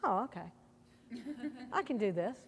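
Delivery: quietly, a touch of relief, half to yourself; soft relieved self-talk. Retold or imitated. A person stretching past discomfort, reassuring themself quietly. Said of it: "And then you".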